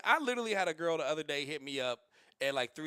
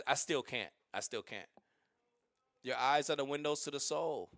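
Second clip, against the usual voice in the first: usual speaking voice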